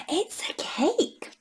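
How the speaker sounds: slow hushed whisper; whispered cake reveal. Acted. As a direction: whisper the surprise.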